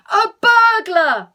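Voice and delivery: very high, very loud, slow cry; alarmed shout. Acted. Father runs to the kitchen, alarmed shout. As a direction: yell the alarm.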